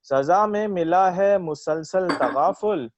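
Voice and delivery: slow, measured verse delivery; ghazal recitation. Acted. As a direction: recite it like a poet at a mushaira. Recites own ghazal at mushaira.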